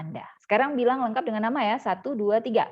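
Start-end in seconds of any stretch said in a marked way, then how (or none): none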